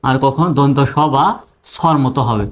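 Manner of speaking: ordinary delivery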